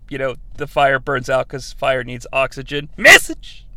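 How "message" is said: sarcastic exclamation. Real, not acted. Sarcastic jab at the movie.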